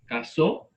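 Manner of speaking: "Casó" stressed on the last syllable so, with a rising end. Shown as a demonstration. Teacher contrasts for students, "Casó" stressed on so. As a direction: land the weight on so in "Casó". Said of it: "cuando digo casó".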